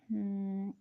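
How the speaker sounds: ordinary talk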